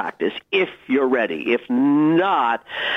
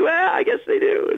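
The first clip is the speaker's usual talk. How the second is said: coy modest shrug. Retold or imitated. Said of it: "I'm like".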